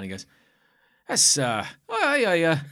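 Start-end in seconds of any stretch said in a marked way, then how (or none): 1.1-2.6 loud, rising flustered recognition; imitated eager fan. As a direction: play a passer-by suddenly recognising it. Imitating eager passer-by at stall.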